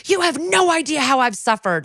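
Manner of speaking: high-pitched dramatic protest; mock outburst, pitched well up; retold anguished outburst. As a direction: act out wounded indignation. Acts out villain's anguished outburst.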